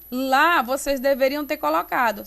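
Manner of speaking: ordinary delivery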